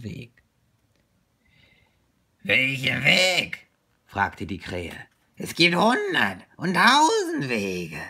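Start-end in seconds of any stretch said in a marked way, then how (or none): 2.4-3.7 loud, drawn-out crow voice. Acted. Crow quizzing Little Bear about the way, crow voice. Crow's voice in the story. 5.4-8.0 loud, higher-pitched crow voice. Acted. Crow lecturing Little Bear on the many ways, crow voice. Crow's voice in the story.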